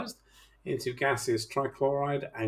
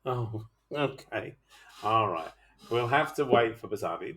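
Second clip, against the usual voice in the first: normal talking voice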